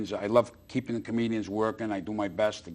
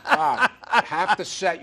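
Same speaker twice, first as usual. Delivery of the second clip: much higher, slow, laughing it out; 12 semitones higher, 7 dB louder, much slower than usual. Real, not acted. Comedian laughing as he coaxes his friend, high and gleeful. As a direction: crack up while making the pitch. Laughing while urging Bob.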